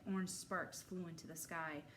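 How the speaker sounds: plain reading voice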